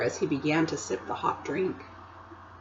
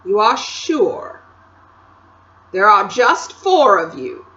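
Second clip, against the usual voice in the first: slow, louder probing queen; pressing Queen; 9 dB louder than usual. A narrator's reading. Queen pressing Edmund about his siblings, slow and loud. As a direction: lean in and demand the answer.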